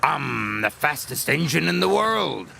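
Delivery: boasting. Acted. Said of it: "he boasted".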